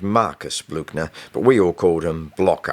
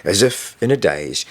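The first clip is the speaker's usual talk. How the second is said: ordinary delivery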